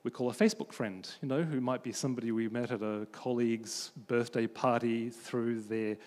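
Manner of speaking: ordinary talk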